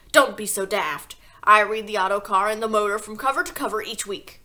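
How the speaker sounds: loud, scoffing father; scornful Mr Wormwood. A narrator's reading. Father scoffing at the teacher, loud and scornful. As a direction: sneer at the idea of books.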